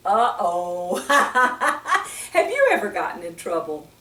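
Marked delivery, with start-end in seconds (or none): 0.1-1.0 playful mock alarm; a teasing uh-oh for the children; storyteller's exaggerated uh-oh. Boy pictured in trouble. 1.0-2.3 short high giggle; real amused giggle. Real, not acted. Laughing at funny book.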